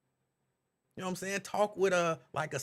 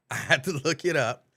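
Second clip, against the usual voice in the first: louder, laughing as he repeats; 2 semitones lower, 8 dB louder than usual. Real, not acted. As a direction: laugh through the words.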